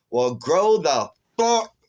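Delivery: loud, drawn-out scolding; retold angry retort. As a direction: throw it back at him, slow and loud. Retold quarrel, cursing him back.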